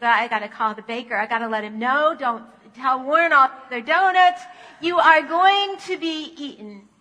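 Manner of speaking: loud, hurried panic; mock panic from a frightened donut; panicked. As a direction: raise the alarm, loud and urgent. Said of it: "And Arnie's panicked".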